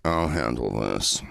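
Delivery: calm tough guy. Retold or imitated. Will reassuring Debbie before the golfers, low and slow. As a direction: play the cool man taking charge. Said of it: "Will goes".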